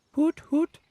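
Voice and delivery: very high owl hooting; owl impression. Doing his owl impression on request, high-pitched hoots. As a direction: hoot like an owl. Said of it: "really good impression of an owl".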